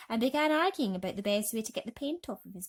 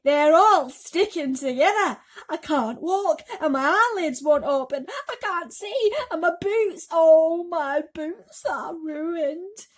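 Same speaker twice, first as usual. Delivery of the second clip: high, loud, slow wailing complaint; loud lament; 8 semitones higher, 11 dB louder, slower than usual. Acted. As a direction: wail it in dramatic despair. Centipede lamenting painted legs.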